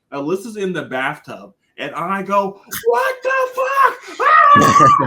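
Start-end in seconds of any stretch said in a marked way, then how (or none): 2.9-4.2 high, loud stunned yell; shocked shout. Retold or imitated. Retelling his shout when the shot went in. Retold reaction to game-winner. 4.2-5.1 piercing shocked scream, very high. Retold or imitated. Screaming at the TV over the step-back.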